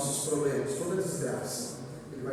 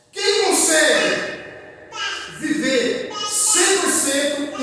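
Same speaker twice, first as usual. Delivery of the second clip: loud, high-pitched sermon challenge; full-force preaching; 11 semitones higher, 9 dB louder than usual. Real, not acted. Preacher challenging the congregation to raise a hand, full-force preaching.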